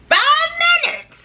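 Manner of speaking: high, slow, stretched exclamation; high drawn-out disbelief; incredulous. Real, not acted. Disbelief at distance.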